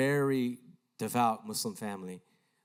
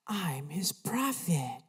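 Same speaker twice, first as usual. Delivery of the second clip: slow, drawn-out eerie voice, a little higher; creepy voice; 4 semitones higher, 3 dB quieter, much slower than usual. Retold or imitated. A disembodied voice speaking to a praying boy, creepy and slow. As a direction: draw it out like an unearthly voice. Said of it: "and it creeped me out".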